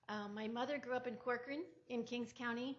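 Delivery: ordinary talk, nothing marked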